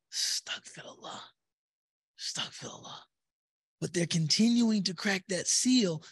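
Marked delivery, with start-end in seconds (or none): 0.1-3.0 breathless softer whisper; whispered astaghfirullah. Pretend. Young man at the website, whispering astaghfirullah. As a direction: hush it while still sinning.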